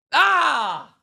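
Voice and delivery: sudden high loud yelp; excited shout. Real, not acted. Streamer reacting to the final card, high excited yell. Last card decides prediction.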